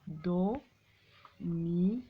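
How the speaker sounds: "Domino" very slowly as do, mi, no; syllable by syllable. Shown as a demonstration. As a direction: dictate "Domino" with long gaps between syllables. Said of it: "frappez les syllabes".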